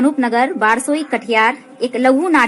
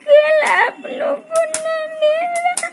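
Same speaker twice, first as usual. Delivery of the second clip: put-on high, slow, sobbing child; child weeping to the uncle; crying child; slower than usual. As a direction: break down in tears over the lost tree. Child cries over tree.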